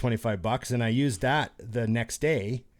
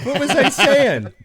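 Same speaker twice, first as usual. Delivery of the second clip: high, loud, drawn-out blurt through laughter; laughing outburst; 11 dB louder, slower than usual. Real, not acted. Friend cutting into the teasing story, high laughing outburst. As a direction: cry it out, much higher and louder.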